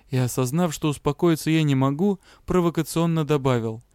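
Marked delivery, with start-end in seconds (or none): none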